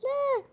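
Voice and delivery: very high wail of pretend grief; mock anguished cry. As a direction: wail no in play despair. Grief at princess death. (صرخة حزن تمثيلية حادة)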